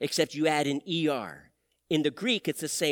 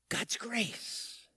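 breathy reverent whisper; whispered awe at grace; 4 semitones higher, 9 dB quieter than usual. Acted. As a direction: hush it like a secret. Whispered after "don't take that too far.

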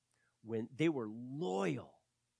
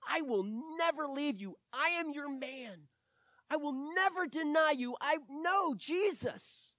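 high, loud, earnest insistence; Peter's vow; 8 dB louder than usual. Retold or imitated. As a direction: swear it like a man sure of himself. Peter's boastful vow of loyalty.

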